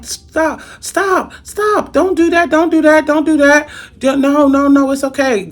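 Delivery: high, rising pleas to stop; urgent high-pitched stop, stop, as if grabbing his arm; frantic pleading friend. Retold or imitated. Friend begs husband to stop, high and urgent. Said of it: "And my friend was like".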